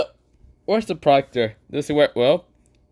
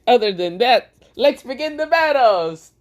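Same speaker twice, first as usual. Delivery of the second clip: Gojo voice; 14 semitones higher, 4 dB louder than usual. Acted. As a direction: play the cocky sorcerer, pitched up. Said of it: "Gojo says".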